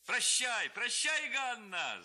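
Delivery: high, loud mocking farewell call; shouting lad. A narrator's reading. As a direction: yell it out teasing. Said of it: "закричало несколько парубков". (парубок громко выкрикивает прощание)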